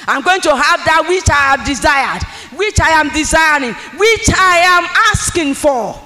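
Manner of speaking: building declaration. Acted. Preacher leads the congregation in a rising shouted declaration.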